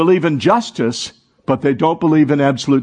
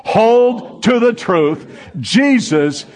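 slow, raised, booming call; boomed declaration; 6 semitones higher, much slower than usual. Shown as a demonstration. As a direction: boom it out with the congregation. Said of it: "I want you to boom it out".

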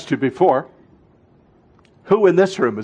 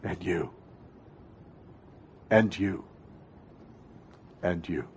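hushed; 7 semitones lower, 16 dB quieter than usual. Real, not acted. Pastor naming listeners one by one.

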